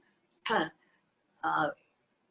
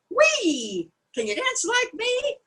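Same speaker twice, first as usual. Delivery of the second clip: much higher squealing frog; excited frog cry; 12 semitones higher than usual. A narrator's reading. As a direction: let the frog squeal up high with joy. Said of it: "frog cried".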